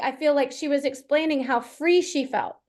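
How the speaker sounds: usual speaking voice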